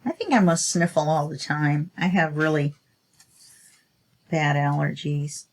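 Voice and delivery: usual speaking voice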